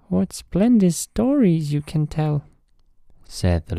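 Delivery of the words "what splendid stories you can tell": high, squeaky little mouse voice; tiny eager mice asking. Acted. Mice praise the tree.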